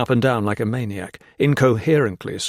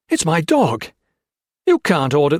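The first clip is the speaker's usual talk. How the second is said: protesting; 6 semitones higher, 6 dB louder than usual. Acted. Hawkins protests.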